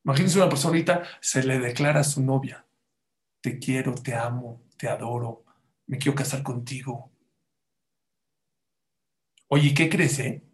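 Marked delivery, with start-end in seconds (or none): 3.4-7.1 soft, low lover's murmur; tender love declaration. Acted. Man declares himself to his girlfriend, tender and hushed.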